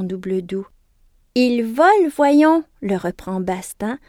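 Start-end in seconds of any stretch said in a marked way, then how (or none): 1.3-2.6 loud, high, triumphant answer. A narrator's reading. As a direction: crow it like the winner. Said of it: "du triomphe dans la voix".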